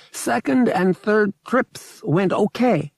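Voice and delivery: high, slow purring alien; slow, lilting alien voice; alien creature voice. Acted. Togorian alien voiced in story.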